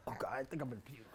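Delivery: whispered nausea; frightened whisper. Acted. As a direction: breathe it in fear. Terrified whisper.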